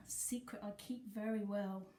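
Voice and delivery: usual speaking voice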